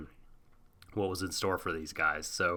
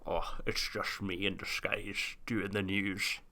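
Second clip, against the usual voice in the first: put-on a softer, lightly held Sean Connery accent; 7 dB quieter than usual. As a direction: keep the Scottish Connery accent going quietly. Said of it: "I have to stop doing the accent".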